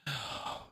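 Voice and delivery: a breathy sigh of annoyance; exasperated sigh. Acted. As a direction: sigh out, fed up with them. Rolls his eyes.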